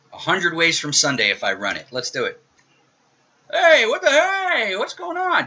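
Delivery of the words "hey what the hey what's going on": much higher, slow, hammed-up bafflement; mock surprise. Teacher faking shock when his demo code fails, high mock alarm. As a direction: pretend to be shocked the program broke.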